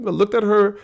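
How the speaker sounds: ordinary talk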